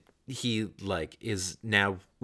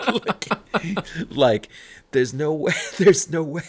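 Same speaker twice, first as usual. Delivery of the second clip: laughing; 9 semitones higher, 6 dB louder than usual. Real, not acted. Laughing at car theft joke.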